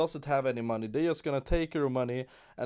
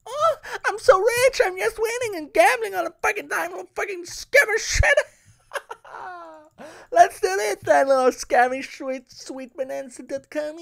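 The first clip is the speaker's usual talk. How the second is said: mocking squeal, much higher and louder; sarcastic high voice; 11 dB louder than usual. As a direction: sneer like a smug winner. Sarcastic mock rich gambler.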